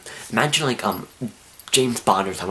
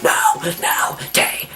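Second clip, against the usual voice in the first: acted fright; much slower than usual. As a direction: panicky mock shouting, voice straining. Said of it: "I'm really scared".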